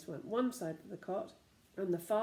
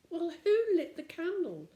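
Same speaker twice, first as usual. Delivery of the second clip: anxious; 10 semitones higher, 8 dB louder than usual. A narrator's reading. Anxious question in story.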